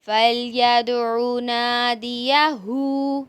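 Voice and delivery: slow, loud tajweed reading; loud recitation. Shown as a demonstration. Teacher reciting the word loudly and slowly for the children. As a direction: call each syllable out slowly for the class.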